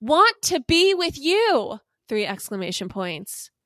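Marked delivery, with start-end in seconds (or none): 0.0-1.8 loud, high, slow mock proclamation; hammed-up shout of a love text; all caps. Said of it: "and then in all caps".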